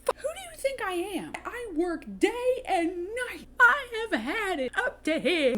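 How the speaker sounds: high-pitched whiny tantrum, slowing into sobs; mock crying tantrum of a spoiled boy. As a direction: throw a bratty fit like a spoiled kid. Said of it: "starts hooting and hollering".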